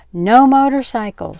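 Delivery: loud, high, emphatic ban; firm refusal. A narrator's reading. Aunt forbidding Frank the ride, firm refusal. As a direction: lay down the law, loudly.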